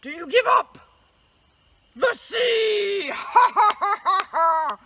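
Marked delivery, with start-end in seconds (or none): none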